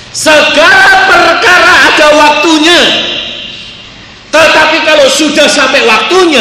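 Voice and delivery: preacher at full force, declaring the promise; full-force preaching. Acted. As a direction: thunder it out to the congregation. Preaching climax.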